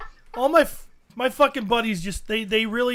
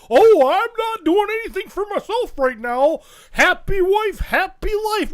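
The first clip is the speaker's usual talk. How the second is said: loud, higher-pitched mock protest; mocking henpecked husband; 7 semitones higher, 7 dB louder than usual. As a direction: parrot a henpecked buddy, loud and high. Mocking henpecked buddies' reply.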